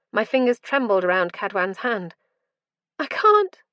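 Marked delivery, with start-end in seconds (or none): 3.0-3.6 much higher and louder, breaking into a sob; sobbing cry. A narrator's reading. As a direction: cry it out in desperate pain. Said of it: "I sobbed".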